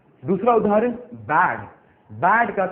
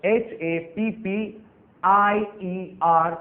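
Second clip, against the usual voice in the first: each letter drawn out slowly, a bit higher; slow spelling; 4 semitones higher, much slower than usual. Shown as a demonstration. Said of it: "उसका comparative हम बना देंगे".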